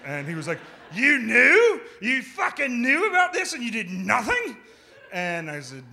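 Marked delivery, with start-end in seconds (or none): 0.9-4.6 high, loud, outraged yelling; furious yell. Retold or imitated. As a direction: blow up at him in disbelief. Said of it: "pointing at me and yelling".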